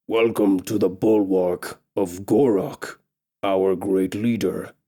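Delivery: slow, low growling orc chief; orc leader voice. Acted. Orc greeting the captive elf, slow and low.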